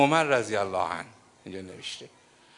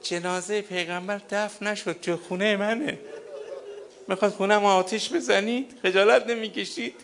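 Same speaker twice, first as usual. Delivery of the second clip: higher, tearful plea of a grieving daughter; preacher voices Fatima behind the door, lamenting, higher and tearful; 6 semitones higher than usual. Retold or imitated. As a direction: plead through tears, wounded. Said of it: "فاطمه پشت در ایستاد. گفت".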